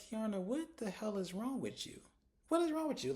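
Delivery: high-pitched incredulous scolding; exasperated disbelief. Retold or imitated. Viewer telling off Kiana over the panel, exasperated. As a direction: demand it as if she has lost her mind.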